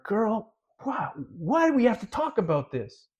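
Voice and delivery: high-pitched, put-on exasperation; acted-out weary protest to a nagging lover; exasperated pleading.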